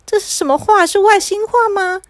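high-pitched baffled questioning; incredulous, pitched well up; bewildered disbelief. Acted. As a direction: ask as if you can't believe your ears. Boy baffled by his own speech.

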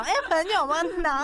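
loud, breezy big-shot generosity; showing off generosity. Retold or imitated. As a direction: wave it off like a big spender. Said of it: "就硬要装豪爽".

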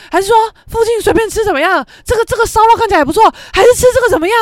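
high, fast, flustered imitation of him; frantic rushing. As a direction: mimic the boyfriend losing it, pushing food choices. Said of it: "他就已经这样疯掉了".

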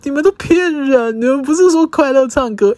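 high, loud comic panic; mock-distressed high complaint; freaked out. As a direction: wail that you've been cheated. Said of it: "我当下真的挫爆了".

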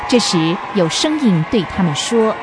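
normal talking voice

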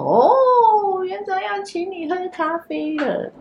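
high singsong teasing call, slowed at the end; teasing kids. Retold or imitated. Classmates tease the boy about seeing the principal, high singsong teasing. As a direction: sing it out like classmates mocking a boy in trouble.

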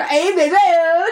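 high, slow, exaggerated resignation with laughter; mock helplessness. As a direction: drag it out like a helpless auntie. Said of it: "这种很无奈". (拖长音、夸张又无奈)

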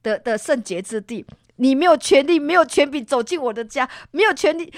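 mother rebuking a ghost in Jesus's name. Retold or imitated. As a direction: cast it out with full authority. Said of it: "一直骂".

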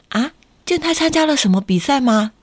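ordinary delivery, nothing marked

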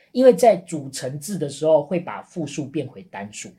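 usual speaking voice

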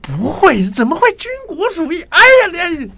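very high, loud mock-reassuring mainland accent; exaggerated mainland Chinese accent. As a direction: ham up the mainland accent in protest. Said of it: "你确定你是用这个口音跟人家讲话吗".

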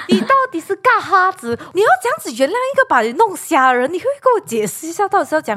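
high, exasperated disbelief; indignant rant. Real, not acted. Friend ranting to friends about the male lead, exasperated. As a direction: vent your outrage at the drama's plot.

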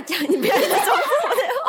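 laughing protest, fast and high; cracking up mid-sentence. Real, not acted.

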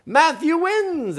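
high, stretched-out cheer; crowd's yell. Acted. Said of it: "everybody yelled".

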